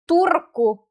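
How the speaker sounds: "Turku" with a strong trilled r; forceful Finnish trill in "Turku"; stronger R. Shown as a demonstration. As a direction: roll the r hard in "Turku". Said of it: "R sounds stronger than in Tampere".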